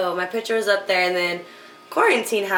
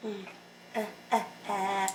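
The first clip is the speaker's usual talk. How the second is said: soft imitated muffled moans, much softer; mock sucking moans; 14 dB quieter than usual. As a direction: hum and grunt softly as if mouth is full. Imitating sucking sounds, retold.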